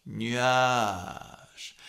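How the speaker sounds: "Nuage" falling into a creaky fry; fry at the end. Shown as a demonstration. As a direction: let "Nuage" crackle down into fry. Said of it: "Sur nuage".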